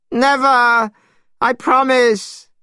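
high, loud, pleading cry; yelped promise under duress; desperate promise. A narrator's reading. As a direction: swear it frantically, pitch way up. Monkey in pain, begging off.

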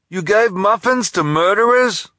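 high and loud disbelief; indignant delivery man. Acted. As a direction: scold him for it. Lou scolds shopkeeper.

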